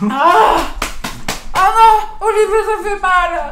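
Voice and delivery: high pained cries, mouth burning; mouth full of hot food. Real, not acted. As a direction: yelp from the spicy burn. Pepper burning her mouth.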